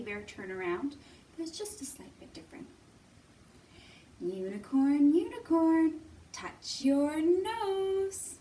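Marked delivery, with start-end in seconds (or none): none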